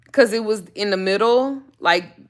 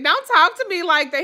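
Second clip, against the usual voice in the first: indignant; 9 semitones higher than usual. Retold or imitated. Repeated indignant quote.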